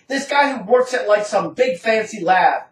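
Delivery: ordinary delivery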